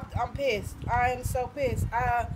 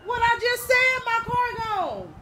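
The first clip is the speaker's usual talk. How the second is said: high, raised-voice repeat; real distressed outburst; exasperated; 8 semitones higher, 7 dB louder than usual. Real, not acted. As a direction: snap it back, upset and impatient. Exasperated at being asked again.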